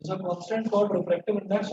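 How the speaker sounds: slow dictation, word groups split by long pauses; classroom dictation. Real, not acted. Teacher dictating a physics rule to students, slow dictation. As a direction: dictate slowly so students can write.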